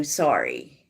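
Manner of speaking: ordinary delivery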